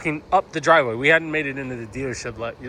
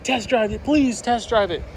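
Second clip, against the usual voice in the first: higher, begging urge; pleading friend; 9 semitones higher than usual. Retold or imitated. Friend Carlos begging the speaker to test drive, high pleading voice. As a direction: beg him to try it. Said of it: "He's like".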